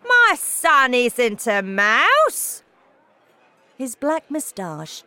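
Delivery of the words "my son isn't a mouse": loud, very drawn-out indignant denial; shouting. A narrator's reading. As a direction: shout it slowly, outraged at the idea. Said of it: "shouted Mister Jenkins".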